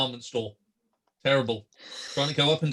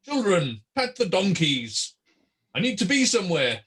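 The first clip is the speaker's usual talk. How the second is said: higher-pitched Santa-style calling; Fungo addressing children; 5 semitones higher than usual. Acted. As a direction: call to the children, each phrase falling from high. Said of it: "then sort of say".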